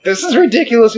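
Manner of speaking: imitated security guard. Security guard to laughing fans on the floor, high-pitched imitation. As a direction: squeal it as the amused guard. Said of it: "the security guard was like".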